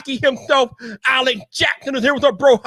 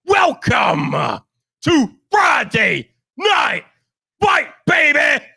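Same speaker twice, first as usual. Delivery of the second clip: hype shout; slower than usual. Real, not acted. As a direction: blast it like a hype man opening the show. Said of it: "don't blow you guys' ears off tonight".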